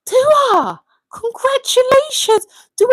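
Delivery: very high, thrilled squeal of congratulations; amazed congratulations. Real, not acted. Streamer hears Dua's mum had a baby, amazed delight.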